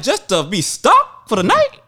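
high, slow retold disbelief; incredulous question. Storyteller balking at paying just to be stranded, incredulous. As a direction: stretch the question out in mock indignation.

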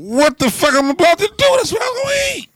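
high, loud comic wail; mock despair. Retelling losing his sandwich, hammed-up high wail of despair. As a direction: whine it like the world ended.

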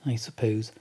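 ordinary delivery

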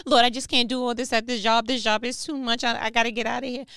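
loud, fast, overwhelmed grumbling to God; stressed complaining prayer. Acted.

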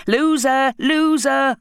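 mock the fallen goalie, shouting; jeering yell. A narrator's reading. Boys jeering at Patrick in the mud, loud taunting shout. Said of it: "yelling and screaming".

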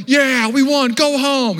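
put-on high, loud kid's victory yell; gloating taunt. Boy taunts the friend he picked on, gloating shout. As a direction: jeer after them, loudly triumphant.

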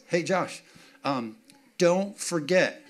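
slow, drawn-out DON'T FORGET after a pause; pointed reminder. Retold or imitated. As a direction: imitate a wife spelling it out: "don't ... forget". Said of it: "and it was like this".

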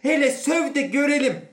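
very high, loud challenge; furious dare. Acted. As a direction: dare him at the top of your voice. Inspector grabs man, dares him.

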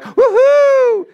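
loud, very high whoop; hammed-up cheering woohoo; celebratory whoop. Preacher acts the crowd celebrating the call, exaggerated high whoop. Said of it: "they're like".

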